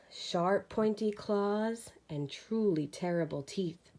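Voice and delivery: ordinary delivery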